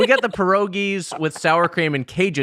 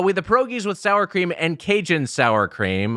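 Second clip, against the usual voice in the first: usual speaking voice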